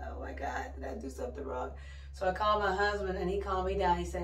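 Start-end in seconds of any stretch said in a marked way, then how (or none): 0.1-1.7 breathless hushed worry, retold; retold worried whisper. New mom retelling her panic over the crying baby, whispered.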